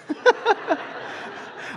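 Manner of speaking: high breathless burst of laughter; laughing. Real, not acted. Laughing at himself after quoting the lie to his wife, high breathless laugh. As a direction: let the laugh break out high and airless.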